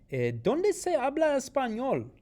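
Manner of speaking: high, loud teacher question; loud quiz question. Shown as a demonstration. Teacher asking the class where Spanish is spoken, loud and high. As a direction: call the question out brightly.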